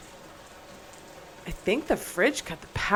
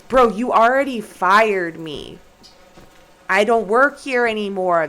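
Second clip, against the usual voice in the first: much louder, emphatic protest; loud exasperated retort; 9 dB louder than usual. Real, not acted. Fired worker telling the boss off, loud exasperation. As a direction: argue back at full voice. Arguing back at game boss.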